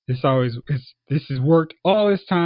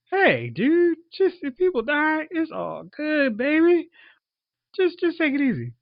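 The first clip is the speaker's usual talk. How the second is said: mock laid-back tempter; 12 semitones higher than usual. Palpatine soothing Anakin as people die, mock mellow. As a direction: coax in a silly high pitch, stretching words.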